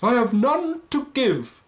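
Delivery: ghostly wail. Acted. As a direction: intone it like a phantom. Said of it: "the ghost replied".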